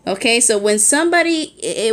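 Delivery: ordinary delivery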